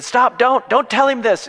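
high, loud imitation of a protesting wife; urgent protest. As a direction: cut him off, acting alarmed. Mentor's wife protesting, retold.

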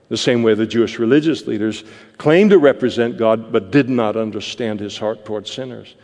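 normal talking voice

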